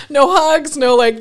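laughing. Real, not acted. Laughing at the abrupt ending.

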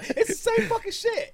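laughing, high-pitched disbelief. Real, not acted. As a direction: laugh through the joke. Joking about NyQuil brands.

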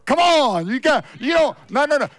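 high, loud insistent pleading; excited pleading. Retold or imitated. New convert pleading with doubting friends, high and excited. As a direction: urge them, excited and insistent.

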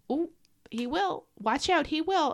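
WILL lifted high and stretched; high-pitched, teasing-warning emphasis on "will"; stress on WILL. Real, not acted. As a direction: linger on WILL as a promise. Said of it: "put emphasis on that word will".

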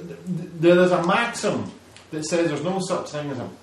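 ordinary talk, nothing marked

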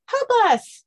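loud, tiny high squeak; high-pitched voice. Acted. Hidden creature begging for rescue, high-pitched. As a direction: cry out in a very high little voice. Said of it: "we hear a high-pitched voice".

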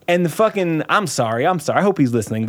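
normal talking voice

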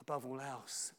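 hushed scripture recital; quiet recitation. Shown as a demonstration. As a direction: murmur the verse to yourself. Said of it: "and just declare it".

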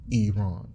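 Iran said as "ee-RAHN"; native-style pronunciation. Shown as a demonstration. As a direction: say Iran to rhyme with Iman. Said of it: "Like Iman".

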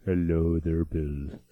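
low, flat synthesized voice; deep robot voice. Retold or imitated. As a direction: drop low and sound like a speech synthesizer. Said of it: "This is his bedroom voice".

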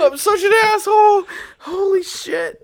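high, loud squeal of guilt; helpless with laughter. Real, not acted. Apologizing while helpless with laughter.

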